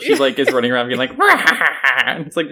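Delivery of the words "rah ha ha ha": imitated maniacal cackle, pitched high; growly "rah" into a mock evil laugh. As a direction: mimic a kid villain's mad cackle. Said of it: "She really does turn that maniacal".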